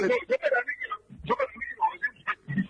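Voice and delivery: robotic, warbling phone line; like talking from underwater; underwater distortion. Real, not acted. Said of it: "Está como bajo el agua".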